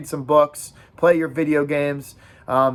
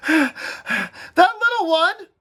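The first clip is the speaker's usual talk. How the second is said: gasped out; 3 dB louder, slower than usual. Acted. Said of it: "gasped Piggy".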